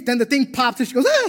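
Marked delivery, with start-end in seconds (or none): 1.1-1.3 short, high mock scream; loud comic yelp of alarm; imitated scream. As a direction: shriek in pretend fright at the pop. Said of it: "So she screams twice".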